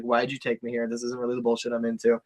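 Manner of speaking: low, subdued mock complaint; mildly upset. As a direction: imitate a quiet, let-down grumble. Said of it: "She'd be kind of upset".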